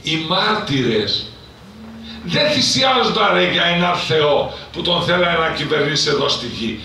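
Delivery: loud, high fiery preaching; preaching at full force. Acted. Priest rousing the congregation to seek God, in loud fiery preaching.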